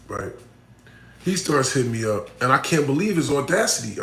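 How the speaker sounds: ordinary delivery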